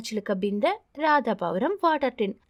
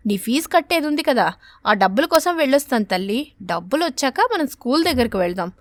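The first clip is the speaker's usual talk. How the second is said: reassuring mother; 6 dB louder than usual. Acted.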